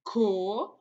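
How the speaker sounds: "Coelho" split co-e-lho; syllable by syllable. Shown as a demonstration. Teacher answering how to separate it, "Coelho" in separated syllables. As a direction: pause after each syllable of "Coelho". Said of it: "Como que nós vamos separar".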